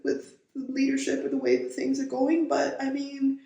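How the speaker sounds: high-pitched, helpless mock excuse; resigned shrug. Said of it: "We were like".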